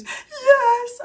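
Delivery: put-on high, loud tearful child; sobbing little boy; crying toddler yes.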